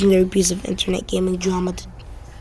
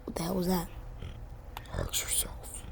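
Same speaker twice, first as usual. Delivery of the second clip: whisper; 2 semitones lower, 17 dB quieter than usual. Acted. Reacting in whispered voices.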